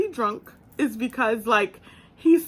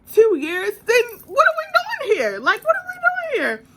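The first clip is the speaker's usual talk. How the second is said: building higher and louder to a laughing peak; rising insistence; 5 semitones higher, 4 dB louder than usual. Retold or imitated. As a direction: push the point harder with each question. Giving him the dating ultimatum.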